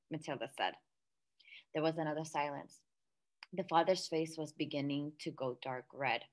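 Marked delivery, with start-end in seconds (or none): none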